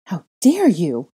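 slow, loud, outraged; spitting indignation. A narrator's reading. Insulted girl rebuking the double, slow indignant spit. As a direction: spit each word out slowly. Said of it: "I spat".

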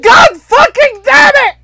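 high, loud laughing rage shout; raging gamer. Real, not acted. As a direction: yell it furious but laughing. Loses to the plus cards.

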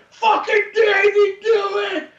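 put-on drunk scream, high and slow; drawn-out cracking drunken shout; drunken fan yell. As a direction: howl it at the stage, blitzed. Drunk fan yelling at concert.

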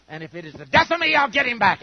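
loud, rising grudge-holder's threat; vengeful vow. Retold or imitated. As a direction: spit out the revenge vow. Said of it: "someone says".

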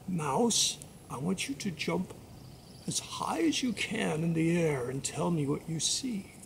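low, soft, measured instruction; magic frog voice. Acted. Magic frog instructing the mouse, low measured voice. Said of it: "and the frog said".

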